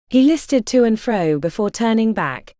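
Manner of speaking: usual speaking voice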